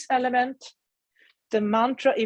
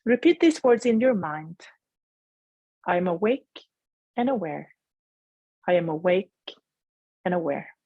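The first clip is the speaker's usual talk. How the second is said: guided meditation. Acted. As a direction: gently guide them inward.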